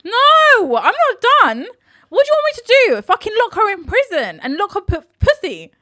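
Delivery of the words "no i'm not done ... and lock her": high, loud, indignant comeback; exasperated protest. Real, not acted. Co-host defending her answer to host, exasperated. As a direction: push back at full voice. Defends herself when challenged.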